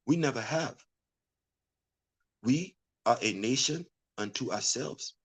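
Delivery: put-on hushed, low, solemn Wakanda accent. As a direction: intone it softly like a proud Wakandan elder. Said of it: "That's the best Wakanda accent I got for you".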